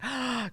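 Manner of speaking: sharp, high intake of breath; mock-thrilled gasp; excited gasp. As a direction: gasp as if just handed backstage passes. Retells her thrilled reaction.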